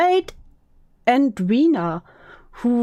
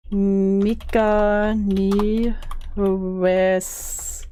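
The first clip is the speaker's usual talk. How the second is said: slow drawn-out reading; 3 semitones lower, much slower than usual. Pretend. Reading a manga title aloud, slowly and drawn out. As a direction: stretch each syllable of the title very slowly.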